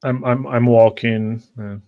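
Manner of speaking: ordinary delivery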